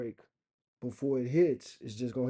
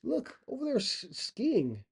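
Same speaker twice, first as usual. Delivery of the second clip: high, halting mock astonishment; imitating an amazed, stammering bystander; 6 semitones higher, slower than usual. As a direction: slowly puzzle it out in a put-on high voice. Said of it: "they'd be like".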